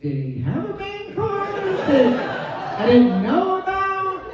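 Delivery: high disbelieving question; puzzled, rising high; incredulous. Retold or imitated. Wife wondering about the man card, high and incredulous. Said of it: "I thought".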